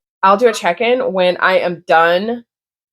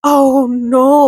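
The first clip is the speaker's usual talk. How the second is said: high, louder dismayed cry; retold dismay; 7 semitones higher, 4 dB louder than usual. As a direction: imitate your own gasp of dismay. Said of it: "I was like".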